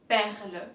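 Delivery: Perle with a throaty French R; guttural French R. Shown as a demonstration. Teacher demonstrating the French R in perle. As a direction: lean on the R in Perle. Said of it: "place the emphasis on the R's".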